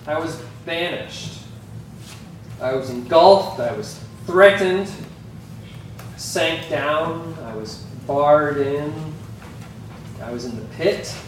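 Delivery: each verb stressed and spaced with pauses; emphasizing key words. Shown as a demonstration. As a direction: recite the circled words one by one, deliberately. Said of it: "I've circled the words that kind of stood out to me".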